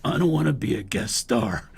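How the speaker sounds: lightly voiced raspy actor; a hint of gruff impersonation; Don Johnson. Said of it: "he goes".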